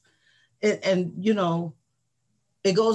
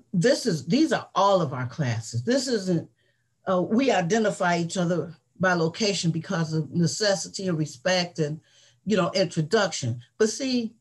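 usual speaking voice

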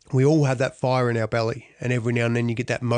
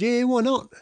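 high-pitched cheerful reply; 10 semitones higher than usual. Retold or imitated. Wife's upbeat reply retold.

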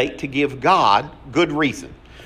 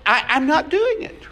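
stubborn Moses; 11 semitones higher than usual. Retold or imitated. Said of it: "He said".

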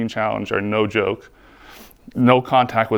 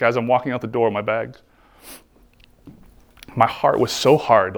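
usual speaking voice

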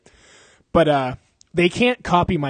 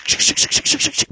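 imitated brake scrape; 3 dB louder than usual. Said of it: "like, the sh".

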